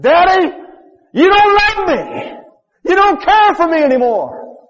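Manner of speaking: put-on high, loud, slow anguished plea of a burned boy; retold child's cry. Burned boy crying out to his daddy from the board, high anguished wail. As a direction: wail it like a child in pain begging his father. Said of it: "And he cried out".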